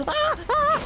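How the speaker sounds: high shrill yelps of fright; startled scream. Real, not acted. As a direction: scream as something jumps out. Jump scare in game.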